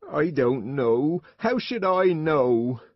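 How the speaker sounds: slow, drawn-out tearful retort; tearful woman. A narrator's reading. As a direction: wail it wearily through tears. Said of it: "Linda was crying too".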